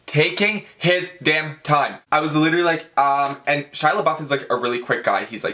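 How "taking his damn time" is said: drawn out, word by word; exasperated drawl. Real, not acted. Fan griping about the slow autograph signer, exasperated drawl. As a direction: stretch it out in exasperation.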